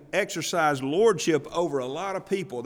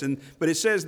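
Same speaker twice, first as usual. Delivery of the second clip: ordinary talk, nothing marked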